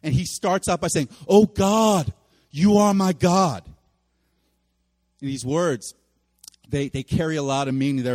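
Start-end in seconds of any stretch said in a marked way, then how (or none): 1.3-3.6 loud, drawn-out cry of prayer; crying out to God. Retold or imitated. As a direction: call out to God with conviction. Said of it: "as he's crying out for God".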